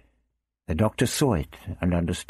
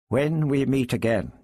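quietly said; 7 semitones higher than usual. A narrator's reading. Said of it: "he said quietly".

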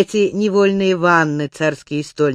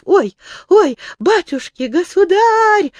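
terrified wail; 13 semitones higher, 4 dB louder than usual. A narrator's reading. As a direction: wail while being thrown in. Stolnik thrown into pond.

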